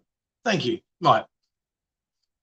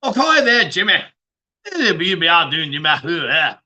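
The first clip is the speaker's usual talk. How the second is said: put-on Scottish accent; 3 dB louder than usual. Streamer doing the forbidden accent, hammed-up Scottish. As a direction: burst into garbled mock Scots. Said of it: "Don't do a Scottish accent".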